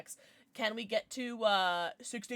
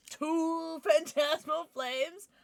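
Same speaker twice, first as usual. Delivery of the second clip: slow, raised, stretched-out count; playful announcer; 5 semitones higher, much slower than usual. Real, not acted. Streamer counts identical card packs, playful announcer voice. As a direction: draw the count out high with a laugh. Repeated pack announcement, increasingly dramatic.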